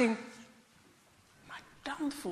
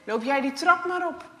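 stern man's voice; 8 dB louder than usual. Acted. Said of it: "de stem boven zijn hoofd zegt".

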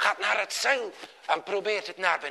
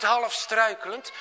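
ordinary delivery

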